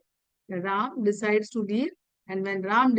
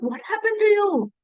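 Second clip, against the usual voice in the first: high, concerned voice asking what's wrong; a worried wife asks her angry husband what's wrong; worried queen; 6 semitones higher, 4 dB louder than usual. Retold or imitated. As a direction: ask anxiously like a caring wife. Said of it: "she's like".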